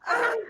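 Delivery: startled playful shriek; mock scream. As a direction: yelp in pretend fright. Reacting to slammed cleaver.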